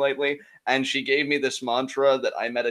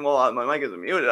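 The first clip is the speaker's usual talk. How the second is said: usual speaking voice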